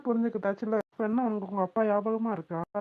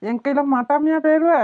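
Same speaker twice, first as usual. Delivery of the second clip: playful threat; 2 semitones higher, 9 dB louder than usual. Acted. Husband playfully threatening wife.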